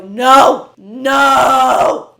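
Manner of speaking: loud anguished cry of no. Retold or imitated. Hearing Girls Want Girls in the car, loud pained shout. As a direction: shout it as if the song hurt you. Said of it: "I just go".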